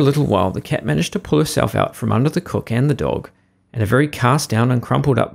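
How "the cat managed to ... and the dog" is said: ordinary delivery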